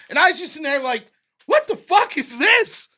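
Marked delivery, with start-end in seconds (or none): 1.5-2.9 slow, high-pitched incredulous exclamation; baffled disbelief. Retold or imitated. Onlooker watching a speed debater, baffled disbelief. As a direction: stretch it out, much higher, utterly bewildered.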